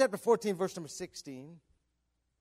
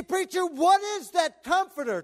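loud, high pulpit question; acted-out, emphatic asking; imitated questioner; 11 semitones higher, 10 dB louder than usual. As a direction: call out the question as a congregant would. Voices a questioning listener.

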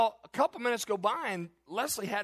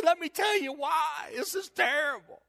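distressed exclaim; 6 semitones higher than usual. Retold or imitated. Pastor telling Steve Wiggins why he's red-faced, embarrassed outburst. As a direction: let it burst out in embarrassment.

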